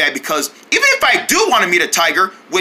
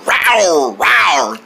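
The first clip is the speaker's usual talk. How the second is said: falling mock tiger "rawr"; tiger roars; 3 semitones lower than usual. Tiger fan imitating one, roaring. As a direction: roar like a playful tiger.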